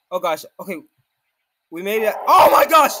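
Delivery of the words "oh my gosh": loud, high shocked cry; loud high gasp of disbelief; shocked shout. Real, not acted. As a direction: blurt it out at the sight of a giant boss. Sees the Great Ape.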